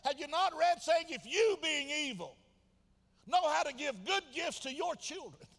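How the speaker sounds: high, loud, driving sermon delivery; full-force preaching. Real, not acted. Preacher challenging those who keep asking, full-force preaching. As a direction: hammer the questions home at the top of your voice.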